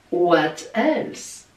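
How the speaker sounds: put-on "what else?" in a light English accent, like the coffee ad; playful English-accent catchphrase. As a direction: quote the ad line in a mock English accent. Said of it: "Vous avez vu l'accent".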